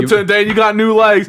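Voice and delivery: hammed-up Gump calling out to Dan; Forrest Gump. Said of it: "it sounds more Forrest Gump".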